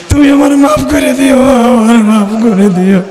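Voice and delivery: wailing, chanted plea that sinks at the end; acted tearful plea. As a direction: beg mother's forgiveness as a doomed son. Drowning son begs mother's forgiveness. (কান্নার সুরে মাফ চাওয়ার অভিনয়)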